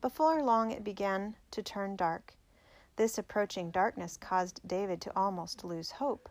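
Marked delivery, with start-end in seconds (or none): none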